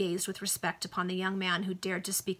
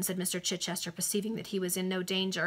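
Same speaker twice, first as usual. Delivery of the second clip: plain reading voice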